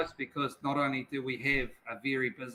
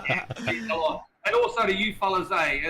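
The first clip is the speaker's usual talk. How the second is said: laughing; 7 semitones higher, 7 dB louder than usual. Real, not acted. Laughing thanks.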